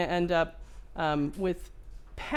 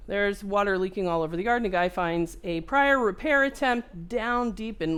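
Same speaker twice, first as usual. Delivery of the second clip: ordinary talk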